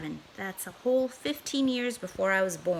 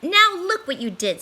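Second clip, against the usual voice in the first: higher, louder scolding fish; angry fish; 8 semitones higher, 7 dB louder than usual. Acted. Fish scolding the cat for the mess, angry. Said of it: "said the fish to the cat".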